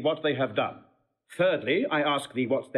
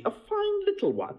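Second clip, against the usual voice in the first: mimicking Beatrice. Mimics Beatrice in his story.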